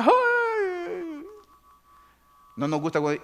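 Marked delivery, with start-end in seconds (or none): 0.0-1.2 very high drawn-out ay of delight; delighted squeal. Retold or imitated. Believer hearing a good prophecy, squealing. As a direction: squeal with joy.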